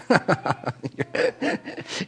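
soft unvoiced chuckle; quiet breathy laugh. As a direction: let a quiet laugh slip out. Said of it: "And I laughed".

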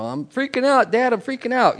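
imitating panicked son. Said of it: "story about my two sons".

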